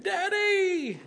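high piercing scream of Daddy; retold child's frightened scream; daughter's scream. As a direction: cry out for your dad in panic. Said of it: "I heard my daughter scream".

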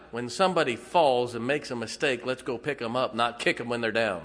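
usual speaking voice

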